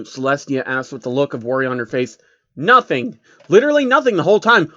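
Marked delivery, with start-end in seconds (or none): 2.6-4.7 exasperated. Acted. Exasperated guard reports.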